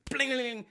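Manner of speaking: high, trilled metallic rattle; imitated bling. Describing the tire flying back on, imitated bling. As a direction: mimic metal ringing as it lands.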